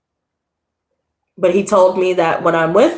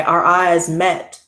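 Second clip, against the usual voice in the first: normal talking voice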